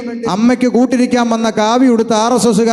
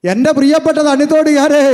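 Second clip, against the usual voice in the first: higher-pitched rallying call; ringing address to the crowd; 5 semitones higher than usual. Real, not acted. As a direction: hail the whole town from the pulpit. Preacher calls out to townspeople.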